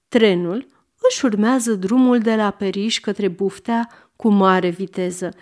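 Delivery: ordinary delivery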